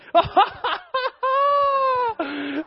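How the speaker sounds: very high, drawn-out cackle; imitated high laugh. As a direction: mimic a helpless high-pitched laughing fit. Cousin's reaction to son falling.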